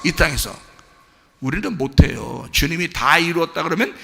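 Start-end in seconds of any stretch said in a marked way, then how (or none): none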